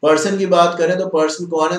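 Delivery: ordinary talk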